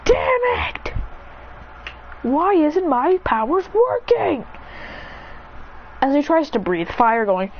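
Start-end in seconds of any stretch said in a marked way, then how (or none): 0.0-4.4 much higher, cracking cry of frustration, slowing down; frustrated cry. Acted. Adrian cries out when his fire fails, high frustrated wail. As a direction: wail it out like your powers just died.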